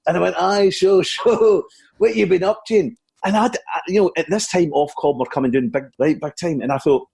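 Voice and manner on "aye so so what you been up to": high, chuckling Scottish comic; Billy Connolly. Acted. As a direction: keep the chat going as the comedian. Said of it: "It's, it's Billy Connolly here".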